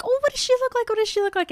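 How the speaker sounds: high-pitched, excited pestering; eager questioning. Acted. Nervous date asking friend what she looks like, eager.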